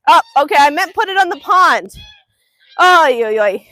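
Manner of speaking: loud flustered exclamation; flustered exclaim. Real, not acted. Owner flustered while dog chases birds, loud exclamation. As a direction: blurt it out in the chaos.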